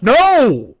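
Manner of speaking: loud, high shouted NO; re-enacted outburst. Diner answering waiter's question about the salad, explosive shout. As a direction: blurt it out as a sudden high yell. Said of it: "then I'm gonna do my response".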